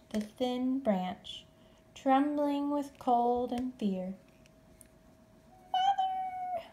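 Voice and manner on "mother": high tiny squeak; a small lost bat calling out; high squeaky little bat voice; squeaky baby bat. A narrator's reading. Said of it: "squeaked Stellaluna".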